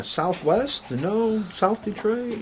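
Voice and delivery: high, quizzical questioning; wondering aloud where that even is; puzzled. Pretend.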